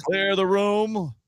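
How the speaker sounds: slow, high announcer call. Pretend. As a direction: boom it out like a show host. Announcer opening the podcast.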